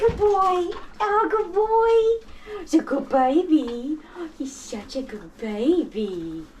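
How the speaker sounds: high, slow cooing. Real, not acted. Cooing at the dog.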